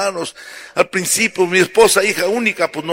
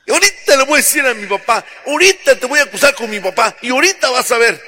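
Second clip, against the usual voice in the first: fast, higher threatening tantrum; spoiled young bride; 6 semitones higher, much faster than usual. Acted. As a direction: rattle off a bratty threat. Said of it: "Tuvo su primer pleito".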